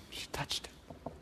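whisper. Acted. Dramatic hush retelling the fall.

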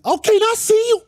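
very high, loud excited call; mock squeal of an impressed fan; high shrieked approval; imitating a hype voice.

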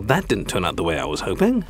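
usual speaking voice

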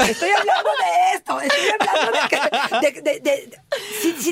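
high laughing voice while tickling. Real, not acted. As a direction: giggle as you tickle him.

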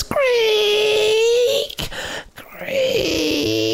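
high squeaky door creak; creaking sound. Acted. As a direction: imitate a slow creaking stair. Narrator voices creaking stairs.

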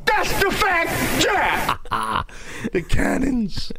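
slow, high, laughing pirate bluster. Pretend. Pirate bit continues, laughing.

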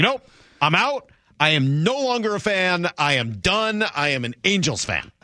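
high, stubborn insisting fan. Retold or imitated. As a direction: dig in and refuse. Mike insisting.

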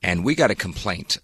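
normal talking voice

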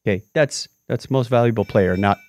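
ordinary delivery

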